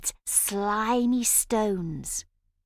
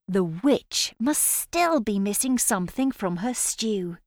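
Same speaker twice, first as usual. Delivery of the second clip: plain reading voice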